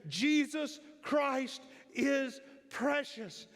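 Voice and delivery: high, loud and very slow proclamation; thunderous declaration. Retold or imitated. Old preacher declaring to the sanctuary, thunderous. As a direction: thunder it out with all the strength left. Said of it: "it sounded like thunder".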